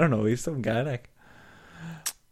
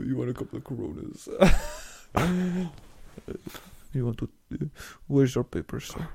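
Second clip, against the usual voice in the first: Vin Diesel; 7 dB quieter than usual. Pretend. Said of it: "Vin Diesel impression".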